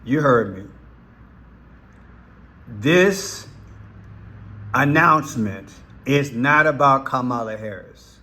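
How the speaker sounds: ordinary talk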